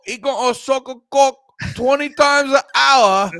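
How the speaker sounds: high, loud mock-British accent that wobbles; hammed-up English accent coming loose; slipping British accent. Mock author raises the count, slipping British accent. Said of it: "slipping out of the accent".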